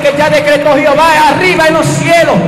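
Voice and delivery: rising to a loud preacher's shout; preaching at full force. Acted. Preacher shouting to his church about heaven's decree, full force. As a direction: thunder it out to the congregation.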